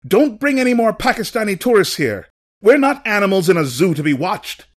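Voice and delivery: high, loud outraged yell; furious protest, pitched high and loud; angry scream. A narrator's reading. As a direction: scream it out at the intruders. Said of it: "he screamed out".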